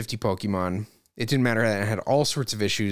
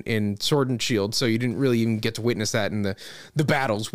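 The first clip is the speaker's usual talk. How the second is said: usual speaking voice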